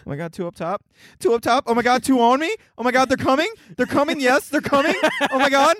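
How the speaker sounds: high-pitched, mock-panicked gamer; frantic call-outs. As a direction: call out in breathless-sounding comic panic. Mimics frantic game call-outs.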